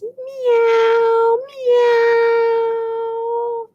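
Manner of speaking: high little kitten meow. Acted. Teacher voicing the gray tabby kitten, a high mew. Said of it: "she says".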